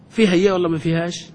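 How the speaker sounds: usual speaking voice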